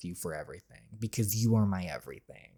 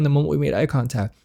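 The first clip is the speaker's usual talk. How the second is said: ordinary talk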